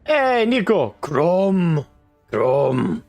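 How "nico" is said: normal talking voice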